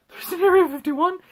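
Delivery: high, loud incredulous question; exaggerated shocked question; mock astonishment. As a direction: squeak it out in hammed-up disbelief. Acting shocked film character.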